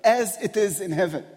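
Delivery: full-force preaching. Acted. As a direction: build the sermon to its peak. Preaching at rising intensity.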